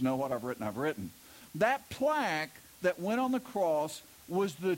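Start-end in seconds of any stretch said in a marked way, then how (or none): 0.0-1.1 firm Herod. Retold or imitated. Herod refusing to change the plaque, low and firm. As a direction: put your foot down, calmly. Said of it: "Herod finally shows some backbone and goes".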